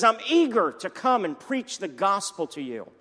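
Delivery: eager, rising into loud enthusiasm; mildly acted apostle's exuberance, building; exuberant eagerness. Apostle Paul writing to the church at Rome, eager and exuberant. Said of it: "he's expressing his exuberance and his heart".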